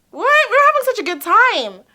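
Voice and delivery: high, loud incredulous objection; acted-out bewildered outburst; baffled protest. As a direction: protest in high-pitched disbelief. Her baffled reaction to his remark.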